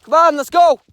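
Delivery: loud, high-pitched call to a dog; real shouted summons, pitched well up. Real, not acted. Calling the dog along.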